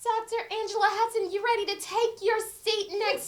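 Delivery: high-pitched announcement, a laugh creeping in; excited host introduction. Real, not acted. Host calling Doctor Angela Hudson to her seat, excited. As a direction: call her up with rising delight.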